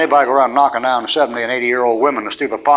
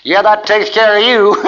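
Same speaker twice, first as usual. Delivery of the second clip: wife's mocking laugh; 7 semitones higher than usual. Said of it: "I heard his wife laugh and say".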